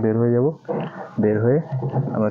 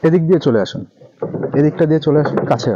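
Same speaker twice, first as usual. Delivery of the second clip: louder, calling across; 6 semitones higher, 7 dB louder than usual. Real, not acted. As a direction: call out loudly to bring them over. Calling someone over outdoors.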